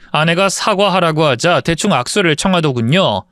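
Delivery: ordinary delivery, nothing marked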